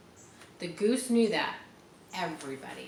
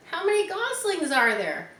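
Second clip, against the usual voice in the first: high, bright piglet voice; voiced pig; 9 semitones higher, 4 dB louder than usual. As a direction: lift it up for little Wilbur. Reading Charlotte's Web to kids.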